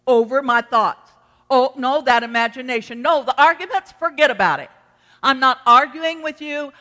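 loud preaching rebuttal, building to a shout; defiant arguing back. Acted. Believer refusing to argue with bad thoughts, loud and defiant. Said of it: "You have to argue with yourself".

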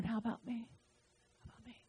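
a small voice pleading to be heard; the Spirit pleading. Acted. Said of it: "And the Spirit saying".

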